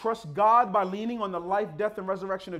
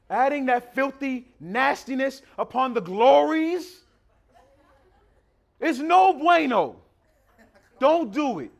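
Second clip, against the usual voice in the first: slow, loud, higher emphatic warning; slow fiery preaching; 5 semitones higher, 7 dB louder, slower than usual. Acted. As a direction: drag each word out in warning. Preaches at full force.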